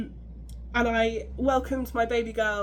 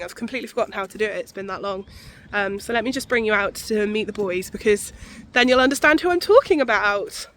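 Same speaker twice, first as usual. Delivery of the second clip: ordinary talk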